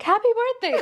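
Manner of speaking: high, awkward mock reply; awkward blurt. Singer blurting a wrong reply to praise, mock awkward and high. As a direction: blurt it out far too high.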